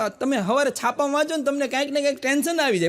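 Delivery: ordinary talk, nothing marked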